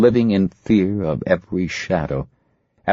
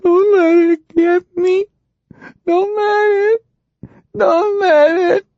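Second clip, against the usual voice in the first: put-on much higher, crying child pleading, slow; terrible dread; 4 dB louder, slower than usual. Marcy begging Ernie not to let it get her, terrified crying. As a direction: beg in terror through tears. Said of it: "but with terrible dread".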